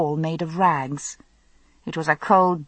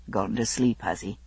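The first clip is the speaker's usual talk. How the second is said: low man's voice; 8 semitones lower, 7 dB quieter than usual. A narrator's reading. Jamie speaks over sleeping child.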